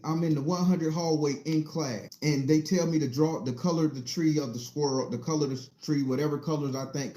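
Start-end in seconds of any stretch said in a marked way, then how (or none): none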